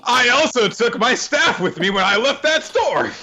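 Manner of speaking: high, hammed-up proclamation; emphatic mock declaration. Co-host adding a boast about leaving the store, mock-emphatic.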